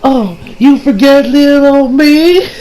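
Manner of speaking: slow, high southern belle lilt; coy southern accent, rising pitch. Retold or imitated. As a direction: put on a drawn-out southern accent. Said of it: "I gave her a southern accent".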